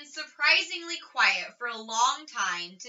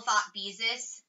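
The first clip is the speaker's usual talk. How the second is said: ordinary delivery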